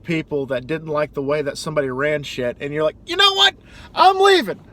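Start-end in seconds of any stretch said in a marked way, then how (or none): 3.1-4.6 high, loud mock colonist storming off; hammed-up fed-up colonist quitting; mock defiant colonist. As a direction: squeak out a huffy exit. Acted colonists quitting England.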